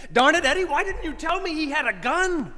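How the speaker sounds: loud, high exclaiming; retold shout. Preacher yelling at Eddie about the gun.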